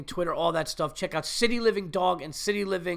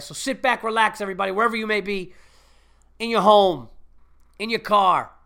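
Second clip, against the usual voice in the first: announcer voice; 4 semitones higher than usual. Acted. Podcast host welcome intro.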